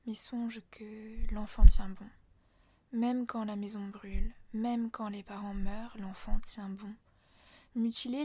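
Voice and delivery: measured, deliberate poem recital; poetry reading. Acted. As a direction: read the poem aloud, line by line. Lit le poème en entier. (lecture de poème posée)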